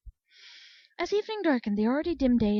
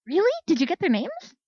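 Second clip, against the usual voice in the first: eager; 9 semitones higher, 6 dB louder than usual. Acted. Claire brightens into grin.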